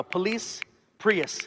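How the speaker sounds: ordinary talk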